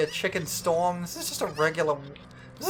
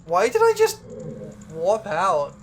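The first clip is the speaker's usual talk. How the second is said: shocked; 6 dB louder than usual. Real, not acted. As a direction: caught off guard, voice jumps up. Suddenly warped out unexpectedly.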